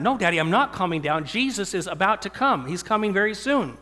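put-on a small girl insisting at the dinner table; little daughter preaching. Father voicing his daughter urging the family at dinner, in a high urgent child voice. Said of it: "she says".

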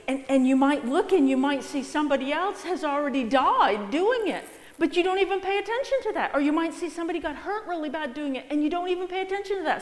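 preacher racing through the warning; urgent rising warning. Real, not acted. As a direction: build the alarm quickly.

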